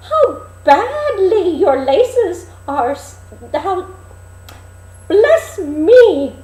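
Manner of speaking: put-on very high, loud, slow old peddler call; a disguised queen hawking like an old crone; peddler woman crying wares. Queen as old peddler calling at the dwarfs' door. Peddler fusses over laces.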